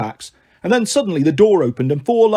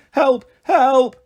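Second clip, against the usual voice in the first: high repeated yell; high-pitched plea; shouted cry for help; 7 semitones higher, 4 dB louder than usual. A narrator's reading. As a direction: call out desperately for rescue. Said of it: "shouted Malcolm".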